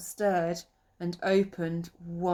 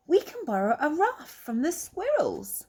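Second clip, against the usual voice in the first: higher, lightly voiced eager girl; eager girl rabbit; 8 semitones higher than usual. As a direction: pitch it up for little Lily. Lily's eager suggestion.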